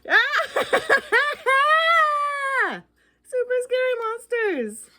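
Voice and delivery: high, loud shriek of fright; hammed-up scared ghost; mock terror scream. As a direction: scream at the monsters.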